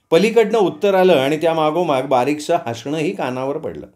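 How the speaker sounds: plain reading voice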